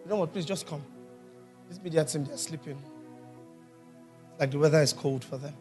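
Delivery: ordinary delivery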